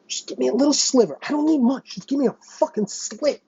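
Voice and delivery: high, strained plea; high-pitched desperate begging; pleading. Real, not acted. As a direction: beg for just a sliver, voice cracking up high. Pleading rant, voice jumps up.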